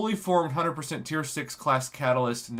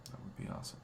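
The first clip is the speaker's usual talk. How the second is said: soft breathy whisper; 7 semitones lower, 20 dB quieter, much faster than usual. Shown as a demonstration. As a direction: whisper the request. Repeats request in a whisper.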